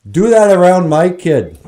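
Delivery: angry dad shout. Retold or imitated.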